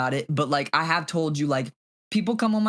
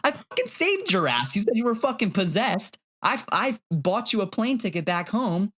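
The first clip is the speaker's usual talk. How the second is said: retold anger; 6 semitones higher than usual. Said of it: "I'm just thinking".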